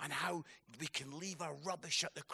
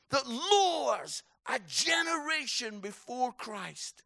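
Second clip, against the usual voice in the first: builds from a raised voice to a full shout, higher and louder; preaching at full force; 8 semitones higher, 8 dB louder than usual. Acted. Preacher rallying the conference crowd, building to a shout. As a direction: swell it into a rousing altar-call cry.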